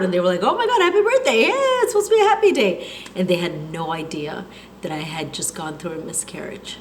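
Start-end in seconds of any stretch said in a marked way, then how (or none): 0.4-2.9 high, laughing party greeting; cheerful friends. Retold or imitated. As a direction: gush it like happy party guests.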